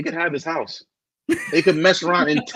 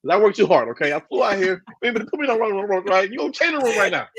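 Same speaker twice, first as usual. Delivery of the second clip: mock hotel complaint; 7 semitones higher than usual. Acted demand at hotel desk.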